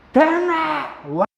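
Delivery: very high, loud swaggering dare in a put-on voice; tough guy challenge. Shown as a demonstration. Teacher playing someone with plenty of nerve, a loud swaggering dare. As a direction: square up and taunt them. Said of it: "이래 하죠".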